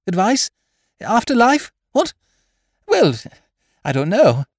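put-on high, flustered young toff; splutter, caught off guard. Wooster put on the spot by the headmistress, flustered. Passed a finger round his collar.

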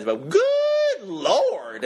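very high, slow drawn-out character voice; high slow hammed-up Cheap Pete. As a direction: stretch it out high like a comic miser. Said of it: "a character called Cheap Pete".

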